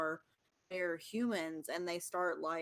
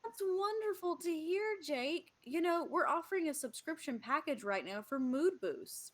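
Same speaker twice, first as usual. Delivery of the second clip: higher, quick sales pitch; salesman God; 6 semitones higher than usual. Acted. God upselling Jake a mood-boost plan, higher and salesy. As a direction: sell the subscription like an eager rep.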